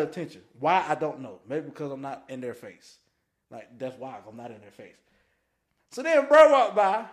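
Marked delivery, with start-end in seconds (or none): 5.9-7.0 excited. Real, not acted. Excited storytelling burst.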